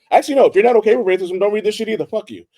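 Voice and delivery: fast, high and loud, firing it off; sudden worked-up rant; heated outburst. Real, not acted. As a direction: blurt it out hotly, talking over yourself. Emphatic outburst correcting herself.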